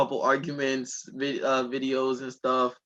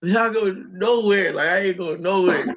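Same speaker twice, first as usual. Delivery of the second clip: loud, high imitation of a mom refusing; mock defiance, raised voice; defiant mom; 6 semitones higher, 7 dB louder than usual. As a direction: put your foot down like an angry mom. Retold mom refusing, gun out.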